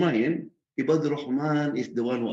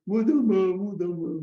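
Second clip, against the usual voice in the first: raised, jeering chant of a nickname; taunting name-calling; 8 semitones higher, 4 dB louder than usual. Acted. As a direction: call it out mockingly, higher pitched. Acting out the name-callers' taunt.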